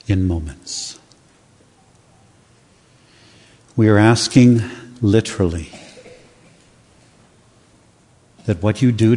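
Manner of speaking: bow your head and pray softly; quiet prayer. Acted. Pastor leading the congregation in prayer, hushed and soft.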